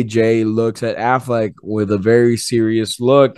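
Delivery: ordinary talk, nothing marked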